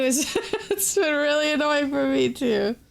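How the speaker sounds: laughing mid-sentence. Real, not acted. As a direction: chuckle through the complaint. Laughing about annoying appointments.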